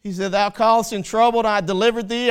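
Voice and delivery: loud, rising sermon voice; preaching at full force. Acted. Preacher to congregation, loud full-force preaching. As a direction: drive it home to the congregation.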